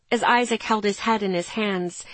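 plain reading voice

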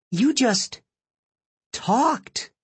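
high, amazed gasp of wonder; stunned disbelief. Retold or imitated. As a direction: say it as if you can't believe it. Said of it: "stared at me with disbelief".

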